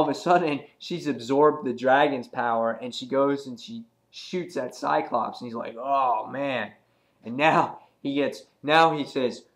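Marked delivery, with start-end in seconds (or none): 5.8-6.7 dismayed villain. Acted. Voices Cyclops after being shot.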